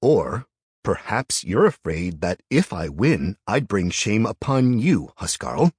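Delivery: slow, loud defiance; defiant. A narrator's reading. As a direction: challenge him to his face. Jarl defies the elder.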